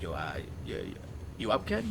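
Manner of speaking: soft, hesitant phone mumble; quiet, halting feeler; late-night caller. Retold or imitated. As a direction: imitate a buddy calling in the small hours. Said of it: "two in the morning and be like".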